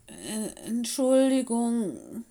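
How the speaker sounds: slow, hesitant, sheepish sorry; ashamed little wolf stammers sorry to the headmistress; stammering apology. Acted. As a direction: stumble out an embarrassed apology. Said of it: "stammelte er".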